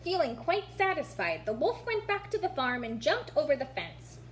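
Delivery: plain reading voice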